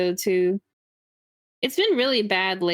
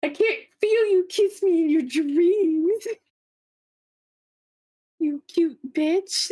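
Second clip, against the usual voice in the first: higher, slow whiny pout; whiny baby voice; 8 semitones higher, slower than usual. Retold or imitated. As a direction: whine for kisses like a spoiled kid. Mock whiny complaint about kisses.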